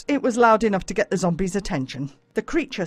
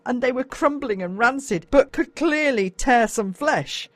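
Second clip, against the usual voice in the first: ordinary delivery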